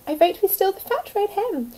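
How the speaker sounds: lightly voiced greedy thief; mild villain voice. A narrator's reading. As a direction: pitch up the plotting. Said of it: "But Hefty Hugh said".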